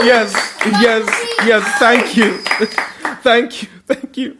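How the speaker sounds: high, loud, gushing award winner; mock acceptance speech. Winner thanks the Academy, family and God, mock-overwhelmed. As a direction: play an overwhelmed Oscar winner over applause.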